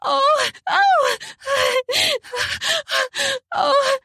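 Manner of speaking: high squealed moans; moaning and panting. Acted. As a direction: pant between high cries. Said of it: "moaned and squealed and panted".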